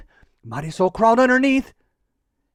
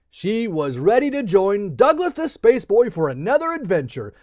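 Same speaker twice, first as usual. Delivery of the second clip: usual speaking voice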